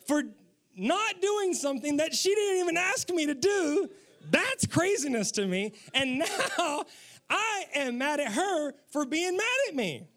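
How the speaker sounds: high, emphatic comic rant; mock exasperation. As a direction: build an incredulous, hammed-up complaint. Husband builds mock complaint at wife.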